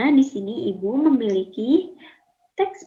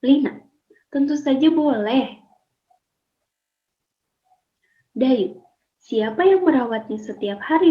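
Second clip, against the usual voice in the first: put-on louder, lively read-aloud of a two-girl dialogue for pupils; 2 semitones higher, 5 dB louder than usual. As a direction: perform the dialogue for the class so they can copy it. Teacher reads dialogue aloud. (membaca dialog dengan lantang dan ekspresif)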